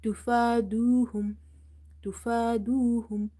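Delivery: تفادوهم with long "faa" and long "duu"; stretched vowels. Shown as a demonstration. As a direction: hold the fa and du in تفادوهم. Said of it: "We will stretch fa".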